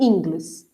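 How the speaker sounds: inglês stressed wrongly on the first syllable, ÍN-gles; slow inglês with the weight on in; misplaced stress. Shown as a demonstration. Teacher showing how nobody says inglês, stressed on the first syllable. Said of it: "você não fala".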